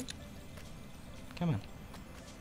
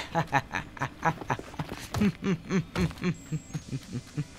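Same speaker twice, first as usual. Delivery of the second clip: long laugh; 4 semitones higher than usual. Real, not acted. Player laughs at killed creature.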